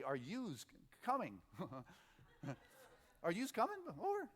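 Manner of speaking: lightly voiced young Bob asking; a hint of a kid's eager question; child saying yous. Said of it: "he would say".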